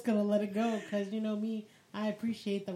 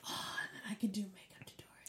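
whisper; 2 semitones lower, 10 dB quieter than usual. Real, not acted. Excited whispered idea.

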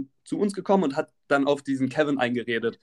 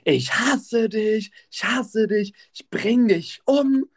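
high, drawn-out mock scream; imitated screaming; 6 semitones higher, 3 dB louder, much slower than usual. Woman raging at her partner on the bus, mock screaming. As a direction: stretch it like a hysterical screamer, held back. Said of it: "fängt da auf einmal diese Jacqueline an zu schreien".

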